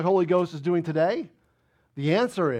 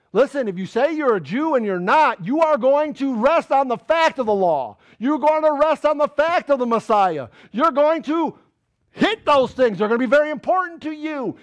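loud, raised-pitch sermon warning; forceful preaching; 9 semitones higher, 8 dB louder than usual. Real, not acted. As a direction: drive each point home with rising force.

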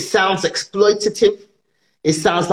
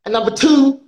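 high-pitched indignant lecture; exasperated correction; 6 semitones higher than usual. Acted. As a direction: set them straight point by point, pitched high.

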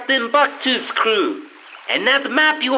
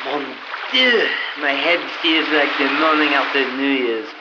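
put-on French accent; 8 semitones lower than usual. Frenchman wakes on beach.

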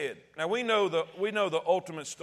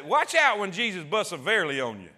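usual speaking voice

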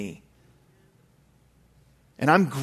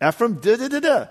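higher, louder sputtering dad; mock angry sputter; 9 semitones higher, 4 dB louder than usual. Angry dad sputtering at his five-year-old son. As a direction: sputter like an angry father lost for words. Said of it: "I'm just got the angry face on".

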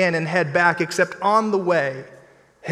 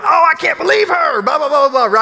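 much higher, loud mock outrage; shrill indignant friend impression; friend joining in the anger; 10 semitones higher, 7 dB louder, faster than usual. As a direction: play the friend who gets fired up with you. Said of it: "They're like".

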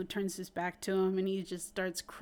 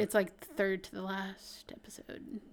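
whisper; 5 dB quieter than usual. Real, not acted. Answers question in a whisper.

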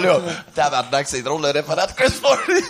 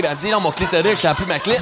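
normal talking voice

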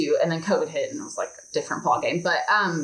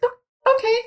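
higher, louder mock-bright "oh, okay"; a retold, hurt little "oh, okay"; stung "oh okay"; 4 dB louder than usual. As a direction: brush off the hurt with a bright, high "oh". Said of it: "and I was like".

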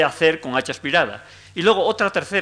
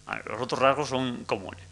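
unvoiced quick aside; whispered aside; 2 semitones lower, 6 dB quieter than usual. Real, not acted. Lecturer drops to a whispered aside. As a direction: whisper it to yourself. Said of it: "whispered aside".

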